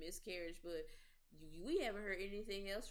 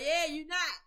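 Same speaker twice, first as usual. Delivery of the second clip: louder, higher mock retort; 7 semitones higher, 12 dB louder than usual. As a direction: fire back with a laughing jab. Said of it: "I'm like".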